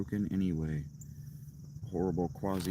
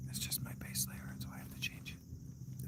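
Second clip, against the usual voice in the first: whisper, no voice; whispered to self; 15 dB quieter than usual. Real, not acted. Talking himself through changing, whispered. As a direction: mutter it under breath.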